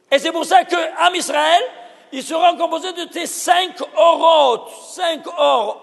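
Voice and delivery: full-voiced sermon shout; fervent preaching. Real, not acted. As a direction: preach it with rising fire. Preacher building to a climax.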